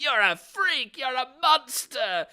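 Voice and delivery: high, loud, hammed-up shrieking tantrum; mocking tantrum. Mocking the husband screaming at his wife, high and loud. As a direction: scream it like a spoiled sore loser. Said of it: "and he's like".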